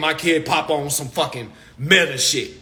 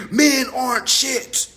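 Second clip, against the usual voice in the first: higher, slow, sassy hint of a fed-up woman; mock women's complaint; 7 semitones higher, slower than usual. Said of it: "women saying".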